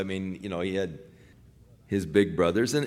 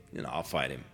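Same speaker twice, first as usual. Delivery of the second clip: put-on offhand kid; 10 semitones lower, 12 dB quieter, faster than usual. David's offer to Saul.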